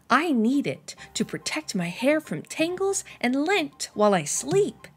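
ordinary delivery, nothing marked

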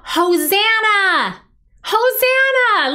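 high, drawn-out joyful shout; crowd shouting. Acted. Storyteller as the crowd hailing Jesus, shouting out. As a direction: hail him slowly at full voice. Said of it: "they were shouting out".

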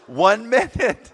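slow, loud, high call. Retold or imitated. Brother stalls the drenched girl, calling out. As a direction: draw it out, hollering to the door.

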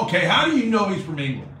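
loud, high mock challenge; challenging retort. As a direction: demand proof from the caller. Acted challenge in retold argument.

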